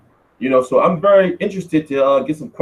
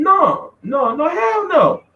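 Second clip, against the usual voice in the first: emphatic denial; 12 semitones higher, much slower than usual. Real, not acted. Denying the cohost's suggestion.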